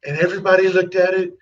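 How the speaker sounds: Trump-style boastful asides, rambling and repeating; sales-pitch Trump impression with emphatic repeats; Trump impersonation. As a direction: do Trump tossing out self-satisfied asides. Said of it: "work Trump's little impersonation, you gotta learn to".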